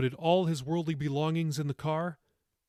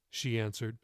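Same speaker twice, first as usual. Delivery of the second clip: plain reading voice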